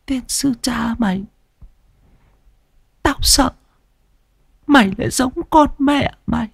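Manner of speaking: put-on high, soft, strained old woman; old grandmother voice. Grandmother confessing to her grandchild, high and strained. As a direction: rasp it out like a frail grandmother.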